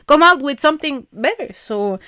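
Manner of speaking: ordinary talk, nothing marked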